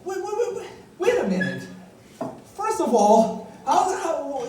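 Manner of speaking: high, incredulous pleading; indignant protest. Retold or imitated. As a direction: squawk it back in disbelief.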